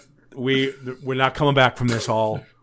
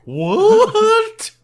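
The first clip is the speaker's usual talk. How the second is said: high, loud mock shock; shocked wife; 8 dB louder than usual. Man mimicking the stunned wife, high and loud. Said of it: "the wife's like".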